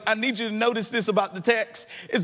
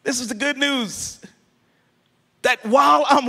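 loud, rising sermon climax over music; preaching at full force; 4 dB louder than usual. Acted. Preacher lifting the congregation over the organ, full force. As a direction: preach it out, louder with each line.

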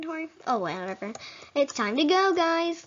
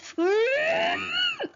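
long rising squeal, much higher; high frustrated squeal. Pretend. Player gets no gold, frustrated squeal. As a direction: let out a mock-tantrum shriek.

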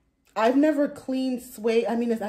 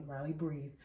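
soothing dad; 6 semitones lower, 11 dB quieter than usual. Retold or imitated. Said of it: "my husband tells Riley".

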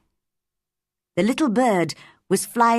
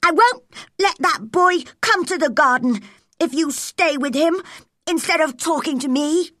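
put-on high, loud, complaining invalid boy; whiny sick boy; 9 semitones higher, 4 dB louder than usual. A narrator's reading. Spoilt sick boy Colin complains to Mary, whiny and loud.

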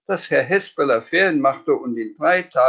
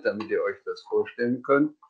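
plain reading voice

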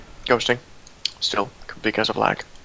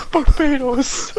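laughing; much slower than usual. Pretend. Laughing goofy cry.

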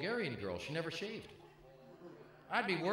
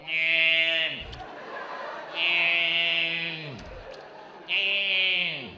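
loud, drawn-out strangled meows; dying cow meow; 7 dB louder, much slower than usual. Retold or imitated. Comic imitating the old cat's dying meow. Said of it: "sounding like a dying cow going".

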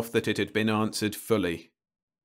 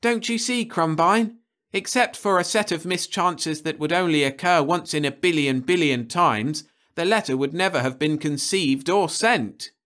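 higher, eager explanation; eager colleague; 9 semitones higher, 4 dB louder than usual. Acted. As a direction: urge Crumbine to see it.

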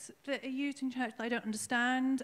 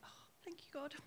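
breathy whisper, far softer; whispered thanks; 5 semitones higher, 17 dB quieter than usual. Retold or imitated. Thanking God for Graham's message, whispered. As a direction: whisper it like a private prayer. Retold grateful prayer.

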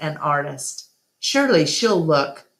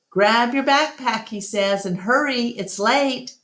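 calling out; 7 semitones higher than usual. A narrator's reading. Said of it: "George calls out to Julia".

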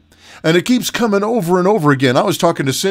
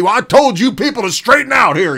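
higher, louder mock harsh rebuke; mock scolding; 6 semitones higher, 3 dB louder than usual. Pastor imitating a harsh God scolding people, mock angry. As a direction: bark at the congregation like a stern God. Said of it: "cold cock us upside the head with and say".

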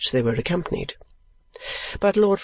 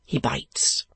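He bites whispered, unvoiced and hushed; He bites breathed as a private whisper; whispered thought; 3 dB quieter than usual. A narrator's reading. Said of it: "thought Ralph".

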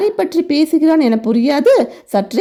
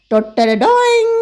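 ta-da fanfare; 10 semitones higher than usual. Acted. Presenting her special dish.

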